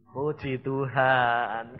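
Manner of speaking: drawn-out, very slow Puji Tuhan; slow praise. Shown as a demonstration. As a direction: savor each word of the praise. Models what the believer should say. (ucapkan pujian dengan sangat pelan)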